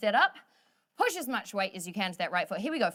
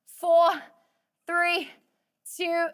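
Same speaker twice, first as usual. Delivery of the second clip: four yelled high and loud, three still raised; shouted countdown; 8 semitones higher, 8 dB louder, much slower than usual. Real, not acted. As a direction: bark the count to drive the last reps.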